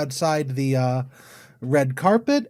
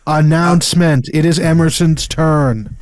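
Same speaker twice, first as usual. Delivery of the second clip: announcer voice; 5 dB louder than usual. Pretend. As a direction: boom it out like a grand announcement. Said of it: "That's my announcer voice".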